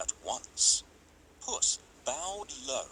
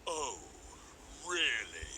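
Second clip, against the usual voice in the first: ogre; 7 dB quieter than usual. A narrator's reading. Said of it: "asked the ogre".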